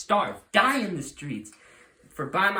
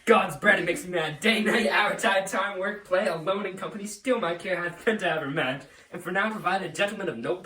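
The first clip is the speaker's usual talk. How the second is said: louder, higher furious outburst at his daughter; furious rant; 3 semitones higher, 5 dB louder than usual. Acted. Father rages at his disobedient daughter, furious and loud. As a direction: let the rage boil over.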